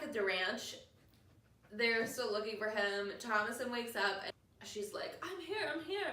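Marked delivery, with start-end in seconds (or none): 5.2-6.1 high, light mock cry of a found girl; imitated girl's call. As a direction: call out high, without volume. Girl calling to searching family.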